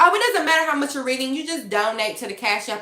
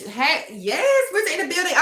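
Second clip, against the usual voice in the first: normal talking voice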